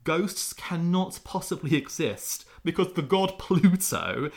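mock-serious argument. Parodies ancient argument, mock earnest.

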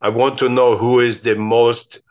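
put-on slow, weighty German-accented statesman voice; thick Henry Kissinger accent. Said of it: "he said in that thick accent".

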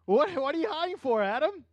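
high, loud amused question; God questioning Adam. Retold or imitated. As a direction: ask like a father who already knows. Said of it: "What did he do?".

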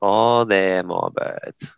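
drawn-out imitation British accent. Co-host copying the practice phrase, slow British accent. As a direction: echo it very slowly in mock British. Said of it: "how to do a British accent".

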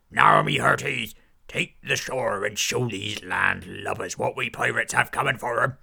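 loud gruff pirate bellow; rally the crew like a swaggering buccaneer; pirate captain. Acted. Pirate captain ordering his crew ashore, loud.

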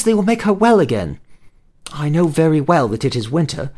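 put-on high, hopeful little girl voice; earnest young girl. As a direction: explain it eagerly like a child. Delphine explains her errand.

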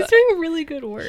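put-on high patronizing baby talk. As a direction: talk down sweetly, like to a child. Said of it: "when you say it in a baby voice".

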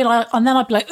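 normal talking voice

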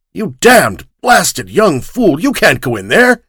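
furious outburst. Acted. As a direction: curse him to stop him at the door. Old man curses the boy.

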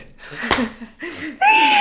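loud, high burst of real laughter; big high-pitched real laugh. Real, not acted. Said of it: "Still laughing though".

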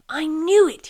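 high, loud exclamation; upset outburst. A narrator's reading. Sloth finds Rat gone inside, upset outburst. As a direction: burst out, sure you were let down.

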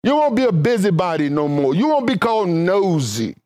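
ordinary talk

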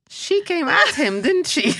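high teasing laugh. Real, not acted. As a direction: tease through laughter.

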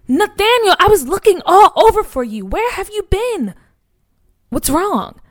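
high, loud, anxious questions, settling down; worried calling out. Acted. Mother finding her son on the steps, alarmed. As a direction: call out to him, then worry.

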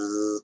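soft, imitated clipper buzz; buzzer noise. Dad warning son of a haircut, soft buzzing noise. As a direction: make a quiet buzzing clipper sound. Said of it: "like that".

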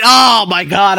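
loud, high oh my God; excited shout. Real, not acted. Vlogger exclaiming about his Vietnamese friends, shouting. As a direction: burst out with delight.

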